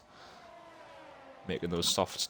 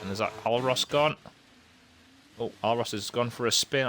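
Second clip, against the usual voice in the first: excited; 4 semitones higher, 3 dB louder than usual. Real, not acted. Car spins.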